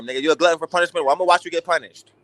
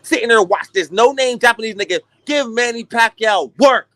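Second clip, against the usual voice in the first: ordinary talk, nothing marked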